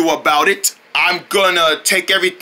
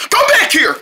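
shout; 12 semitones higher than usual. Pretend. Drone chasing the villain Crowd, shouting. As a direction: call him back.

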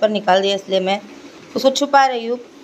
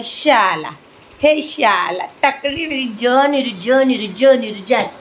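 doting mother cooing at her son; 4 dB louder than usual. Real, not acted. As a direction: coax the little one to look at you, playful and doting.

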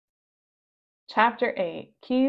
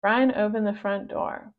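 ordinary delivery

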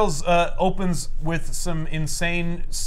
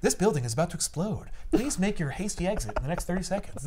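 softer, lightly acted automated announcer; polite loudspeaker voice; 9 dB quieter than usual. Building warning soldiers to leave, polite announcement voice. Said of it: "a polite voice comes on the loudspeaker".

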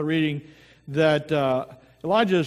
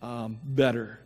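opening prayer; 4 semitones lower than usual. Acted. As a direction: pray gently over the congregation. Pastor leads the opening prayer.

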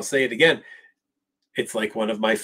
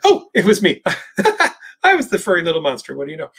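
higher puppet voice with an embarrassed giggle; sheepish Grover; 7 semitones higher, faster than usual. Retold or imitated. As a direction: laugh it off like a shy little monster. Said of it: "it's Grover saying".

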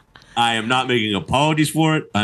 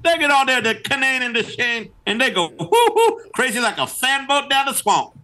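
much higher, hammed-up Cajun voice; mock Cajun accent; 14 semitones higher than usual. Hyping Bourbon Street to the cohost in a much higher mock Cajun accent. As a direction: squeal it like a cartoon Cajun. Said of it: "slide in and out of this offensive accent".

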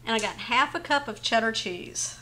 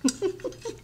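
short bright giggle, pitched up; a quick amused chuckle; light laugh; 7 semitones higher than usual. Real, not acted. Laughs at a joke about hands.

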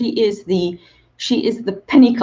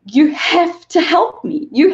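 crying out to God; 7 semitones higher, 4 dB louder than usual. Retold or imitated. Alone in a hotel room, pleading with God, tearful. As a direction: beg for help through tears. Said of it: "I just cried out to God".

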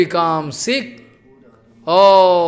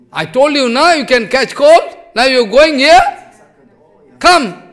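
loud, high scolding that ends in a sharp command; aunt scolding; 6 semitones higher, 7 dB louder than usual. Retold or imitated. Aunt scolds Gaur Nitai for going back, loud scolding call. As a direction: scold him like a fed-up aunt, then snap Come.

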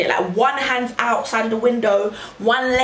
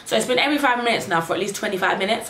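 ordinary talk

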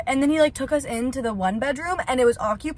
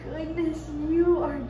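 cooing; 6 semitones higher, 4 dB quieter, much slower than usual. Real, not acted. Cooing at tiny kittens.

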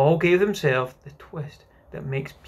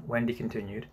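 ordinary delivery, nothing marked

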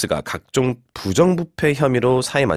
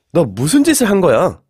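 loud, high-pitched accusing question; alarmed reproach; 7 semitones higher, 5 dB louder than usual. Acted. Teacher acting out the example sentence, loud accusing outburst. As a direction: demand it in shocked reproach.

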